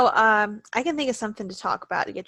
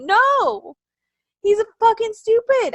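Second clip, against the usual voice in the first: high, loud indignant refusal; retold angry outburst; 12 semitones higher, 7 dB louder, slower than usual. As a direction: argue back hotly at raised pitch. Retold argument, refusing to agree.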